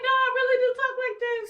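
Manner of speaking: high, loud, incredulous protest; indignant retort. Retold or imitated. Defending her own way of talking to the employer, high and loud. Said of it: "I'm like".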